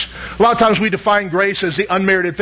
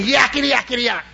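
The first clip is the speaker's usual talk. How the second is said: pitched-up mimicry of a pestering imp; mock yelling devil; 3 semitones higher than usual. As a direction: lightly imitate a devil's noisy yammering. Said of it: "at the top of his voice".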